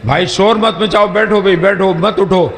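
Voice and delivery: real crowd admonishing. Real, not acted. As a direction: tell a restless crowd firmly to settle down. Announcer orders noisy crowd to sit. (भीड़ को ऊँचे सुर में टोकते हुए)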